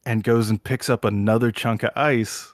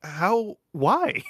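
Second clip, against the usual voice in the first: high-pitched mock bewilderment; simply confused; 7 semitones higher, much slower than usual. Guy after the second window, confused. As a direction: act out someone who can't compute it. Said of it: "from angry to just simply confused".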